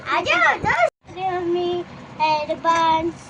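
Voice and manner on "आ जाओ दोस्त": high, loud, drawn-out call. Real, not acted. Child urges friend to come play, calling out. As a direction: call your friend over, stretching the words.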